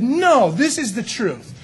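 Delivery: firm, slow claim of truth; first claimant. Pretend. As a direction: state it like the first preacher. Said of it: "both said".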